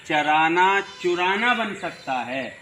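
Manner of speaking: first چرانا with a, second with u; چرانا then چرانا, cha then chu; charana becoming churana. Shown as a demonstration. Teacher contrasts zabar and pesh in چرانا. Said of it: "ایک زبر ہٹانے سے اور ایک پیش رکھنے سے".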